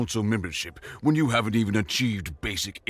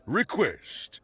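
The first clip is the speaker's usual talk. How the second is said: sharp interruption; 6 semitones higher than usual. A narrator's reading. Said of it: "interruption crackled like a whip".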